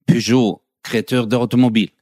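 Peugeot said in a mock French accent, pyʒo; smooth French pronunciation of a brand; mock-elegant French accent. Shown as a demonstration. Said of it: "das hast du schön gesagt".

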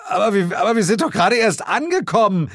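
fast, high, stammering; spluttering, loud-pitched complaint; dismayed protest. Acted. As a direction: plead against the injustice. Stammering protest at cancelled holiday.

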